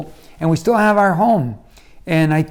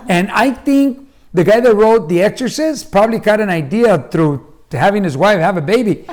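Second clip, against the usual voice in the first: ordinary talk, nothing marked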